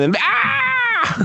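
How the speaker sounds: sudden high shriek; mock scream. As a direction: yelp like a jump scare hit. Mimicking a jump scare in a clip.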